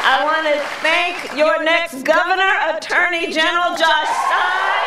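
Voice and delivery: rally speech. Acted. Vice president thanking local leaders at rally, projecting speech. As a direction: address the crowd like a stump speech.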